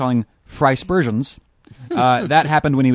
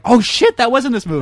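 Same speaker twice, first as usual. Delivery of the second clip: retold sudden realization; 9 semitones higher than usual. Film fan retelling his reaction to a forgotten scene, mock shocked realization. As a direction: act out suddenly remembering, pitch jumping up.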